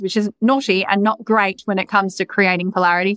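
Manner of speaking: ordinary talk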